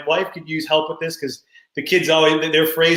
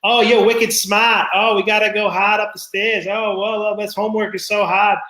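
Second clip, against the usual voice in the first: higher-pitched mock Boston accent; 5 semitones higher than usual. Friend's mom mimicking her daughter's learned accent, higher-pitched Boston accent. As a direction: imitate a kid laying on a thick Boston accent. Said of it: "you taught her this Boston accent".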